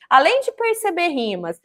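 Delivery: ordinary talk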